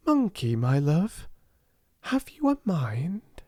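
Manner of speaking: high tender voice to a monkey; tender question. Acted. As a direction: ask a pet gently.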